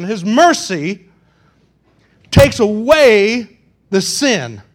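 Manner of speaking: loud, emphatic pulpit preaching. Real, not acted. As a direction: drive each point home to the congregation. Preaches at full force.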